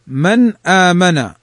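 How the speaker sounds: من آمن with the nun fully sounded, slowly; slow clear reading. Shown as a demonstration. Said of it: "في كلمتين". (نطق واضح بطيء لـ من آمن مع إظهار النون)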